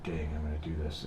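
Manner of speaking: retold thought, dropped low and soft; low, quiet self-talk. Reluctant inner thought, retold.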